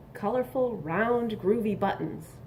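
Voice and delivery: ordinary delivery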